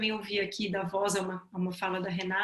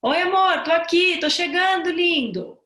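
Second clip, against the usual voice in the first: put-on high, loud sing-out to a crying baby; parent soothing baby; 9 semitones higher, 10 dB louder than usual. Parent calling to a wailing baby, high and loud reassurance. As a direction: call out sweetly to your baby that you're coming. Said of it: "você simplesmente diz pra ele assim".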